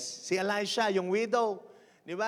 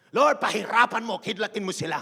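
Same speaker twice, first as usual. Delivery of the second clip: mock vengeful pastor; 5 dB louder than usual. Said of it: "wala namang pastor na naghangad".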